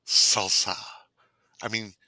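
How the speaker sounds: normal talking voice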